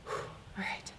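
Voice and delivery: breathy sigh, then a whispered all right. Retold or imitated. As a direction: exhale the fear and whisper it. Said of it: "take a few breaths".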